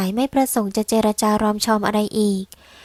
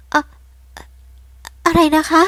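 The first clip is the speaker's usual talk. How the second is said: higher, louder shocked gasp of a question; stunned disbelief; 5 semitones higher, 4 dB louder than usual. Acted. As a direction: stammer out the words in shock. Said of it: "อย่างตกตะลึง".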